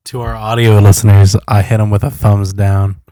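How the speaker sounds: booming aside to the listeners; loud announcement. Real, not acted. Co-host telling audio listeners about his gesture, loud announcement.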